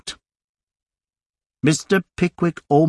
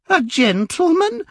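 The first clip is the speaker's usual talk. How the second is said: lady's scream. A narrator's reading. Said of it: "with a terrific scream".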